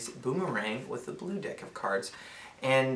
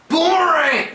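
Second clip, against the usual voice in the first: much higher, loud triumphant shout of Boomerang; 13 dB louder, slower than usual. Acted. Teammate calling out the signal to win, triumphant shout. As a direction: yell Boomerang to claim the win. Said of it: "I should say boomerang".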